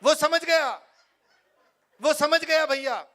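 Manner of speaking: high, loud, earnest politician lecturing the crowd; Rahul Gandhi impression. Rahul Gandhi explaining comedy to the audience, high and loud. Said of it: "Rahul Gandhi जी ... आपको comedy समझाते".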